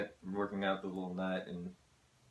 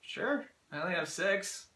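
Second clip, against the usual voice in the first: high, loud mock-eager cannery buddies; imitating cannery workers; 12 semitones higher, 6 dB louder than usual. Mimicking cannery coworkers eager to come over for beers. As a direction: squeak out an overeager beer invitation. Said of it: "you're like".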